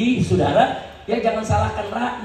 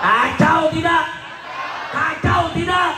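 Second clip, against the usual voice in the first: high, loud call to the crowd; belted rally chant; rallying shout; 7 semitones higher, 4 dB louder than usual. Real, not acted. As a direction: shout the question to rouse the crowd.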